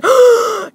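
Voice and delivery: loud, high exaggerated gasp; scandalized gasp. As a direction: gasp in mock outrage. Acted girlfriend's shock at insult.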